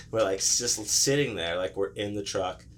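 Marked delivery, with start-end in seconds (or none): none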